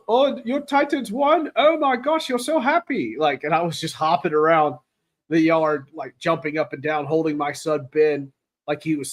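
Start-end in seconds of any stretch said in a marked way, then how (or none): 0.1-3.2 high, put-on neighbor voice, pitched way up; imitating neighbor Bill. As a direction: gush like the delighted neighbor next door. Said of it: "And he goes".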